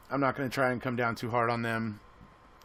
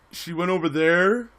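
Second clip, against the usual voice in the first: "She went over there" with a questioning lift at the end; statement as a question; 4 semitones higher, 4 dB louder than usual. Retold or imitated. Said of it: "he phrases it as a question".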